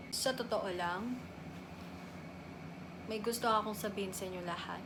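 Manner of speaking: very low, hushed admission; somber confession. Acted. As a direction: confess it softly, with heavy pauses. Confesses her depression diagnosis. (mahina at malungkot na pag-amin)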